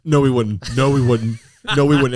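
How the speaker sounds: loud repeated objection, building; emphatic protest. Real, not acted. Host shooting down co-host's claim, loud and insistent. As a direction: cut in loudly and keep insisting.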